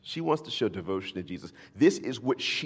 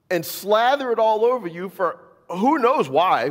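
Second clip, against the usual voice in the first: much higher, loud mocking whine; whiny disciples; 13 semitones higher, 7 dB louder than usual. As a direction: mimic the disciples' complaint, hammed-up. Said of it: "And they're like".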